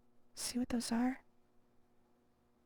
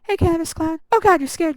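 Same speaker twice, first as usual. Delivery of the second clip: startled loud shout, pitched up; scream into giggles; 5 semitones higher, 13 dB louder than usual. Real, not acted. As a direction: yell out, caught by surprise. Jump scare in the game.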